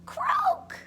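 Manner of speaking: very high-pitched croak; toad croak. A narrator's reading. Narrator voicing the toad's reply, high croak. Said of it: "Toad said".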